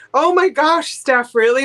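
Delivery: loud high-pitched amazement; loud, high shocked exclamation; amazed. Real, not acted. Surprising high sale price.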